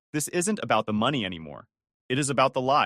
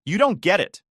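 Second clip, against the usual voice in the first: higher, louder sharp retort; snapping; 7 semitones higher, 6 dB louder than usual. A narrator's reading. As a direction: snap it back at him. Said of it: "she snapped".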